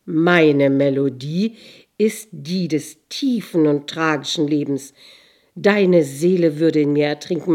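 loud, booming voice of the sea; thundering voice. A narrator's reading. Sea warns the girl of drowning, thundering. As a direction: thunder it out with weighty force. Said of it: "mit donnernder Stimme".

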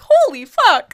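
loud, high retold shock; high, astonished cry at a huge bill; shocked outburst. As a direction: exclaim in hammed-up disbelief.

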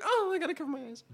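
put-on very high, small girlish voice; high-pitched mock squeamishness; squeamish girlfriend. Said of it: "And she's like".

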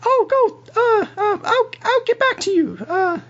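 very high falsetto stalling; squeaky, hesitant brush-off; Clarice, flustered. Acted. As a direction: dodge awkwardly in falsetto. Game master plays Clarice dodging proposal.

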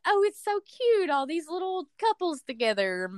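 high, cutesy imitated cooing; mock gushing. Imagined adults admire prom couples, mock gushing. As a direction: gush in a put-on high voice, easing down.

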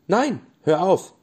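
slow, loud, high plea from a flower; calling out. A narrator's reading. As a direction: call out to make it stop. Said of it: "rief die Blume".

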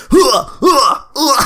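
high breathy "hua" grunts; comic getting-hit sound effect; punching bag grunts. Retold or imitated. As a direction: imitate taking punches, one grunt each. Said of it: "get a punching bag sound effect".

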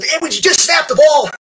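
high, loud, fed-up shout; annoyed yell. Retold or imitated. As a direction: yell at the quarterback to get on with it. Knocked-down guard yelling at quarterback.

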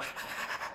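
wordless hushed whisper noise; imitated whispering. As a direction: mimic someone whispering secretly into a phone. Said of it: "he's like whispering".

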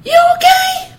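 very high, loud grandma voice; exaggerated falsetto grandmother on the phone; grandmother impression. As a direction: squeal it like a mock concerned grandma. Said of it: "my grandma, she's on the phone".

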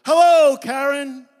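high, loud group shout; room shouting. Acted. Whole AA room shouts hello at Karen loudly. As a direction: bellow a greeting in unison. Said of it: "The entire room shouts".

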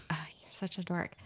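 soft unvoiced tease; whispered teasing. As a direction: whisper it fondly. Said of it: "or like".

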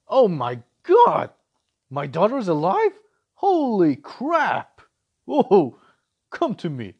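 loud, slow, overjoyed father's cry; overjoyed reunion. Retold or imitated. Father finding his daughter alive, a loud overjoyed cry. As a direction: shout it out like a dad seeing his lost daughter alive. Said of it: "how do you think the reaction should be".

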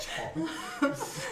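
high, soft mock-royal British; posh British accent. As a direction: echo a British royal, laughing. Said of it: "only one British accent that actually sounds nice".

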